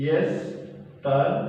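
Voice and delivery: yesterday stretched out syllable by syllable; yesterday broken into slow, separate pieces; slow dictation. Shown as a demonstration. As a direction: dictate yesterday very slowly, as if writing it. Dictating the answer while writing.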